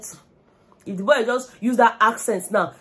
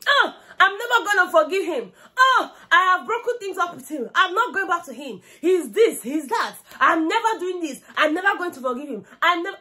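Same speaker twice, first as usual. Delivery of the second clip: high, laughing mock-sulky vow; mocking imitation; 10 semitones higher than usual. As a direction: ham up her sworn breakup, giggling. Mocking housemate Wani's breakup vow.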